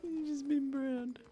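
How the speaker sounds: cracking up mid-line, high and slow; laughing catchphrase. Acted. He finally says the promised catchphrase, laughing. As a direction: laugh through browned. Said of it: "Pick my moment".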